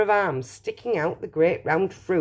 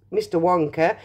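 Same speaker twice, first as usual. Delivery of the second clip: ordinary delivery, nothing marked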